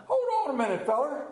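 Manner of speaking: high, laughing tease. Retold or imitated. Devilish cook tempts him.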